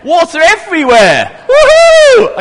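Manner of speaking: laughing, then a high loud whoop; splashing water and can't resist cheering; excited whoop. Real, not acted. Leader splashing the toy boats, cheering with delight.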